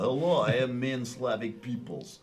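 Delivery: put-on hammy Slavic accent; Russian accent. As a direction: introduce yourself as a mock Russian. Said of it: "all the Slavic accents".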